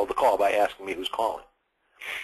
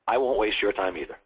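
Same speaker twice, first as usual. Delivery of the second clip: usual speaking voice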